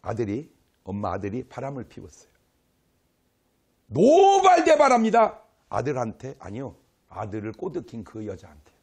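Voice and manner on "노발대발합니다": outraged exclamation. Acted. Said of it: "mother's fury told emphatically".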